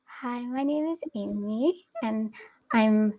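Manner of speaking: put-on soft, slow little girl voice; child's voice. Shown as a demonstration. Asked by the host for a child's voice, soft slow little girl. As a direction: speak like a shy little girl introducing herself. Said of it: "So let's try the children's voice".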